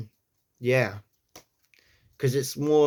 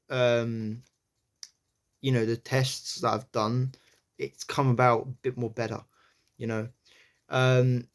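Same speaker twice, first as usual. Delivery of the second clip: ordinary delivery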